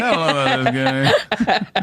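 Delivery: high, slow comic squeal; hammed-up hesitant misgiving; mock doubt. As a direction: drag it out high while laughing. Exaggerating a married person's doubt.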